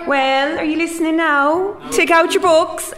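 high, lilting put-on Cork accent. Shown as a demonstration. Teacher calls the class to attention in a Cork accent. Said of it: "or a Cork accent".

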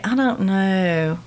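"I don't know" slow in a North Carolina accent. Shown as a demonstration. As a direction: drawl it out coastal Southern. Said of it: "stays in North Carolina".

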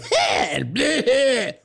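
high-pitched mock alien cry; alien noise imitation. Host remembering the game's alien, high imitated squeal. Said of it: "I remember, like".

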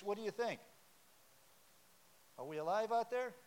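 ordinary talk, nothing marked

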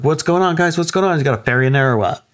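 high playful voice teasing the dogs; man teasing his barking dogs, high and joking. Retold or imitated. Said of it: "kinda joking with his dogs".

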